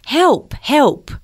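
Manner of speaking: high yelled cries for help; screaming for help. Acted. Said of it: "had to run screaming".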